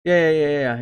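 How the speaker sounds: usual speaking voice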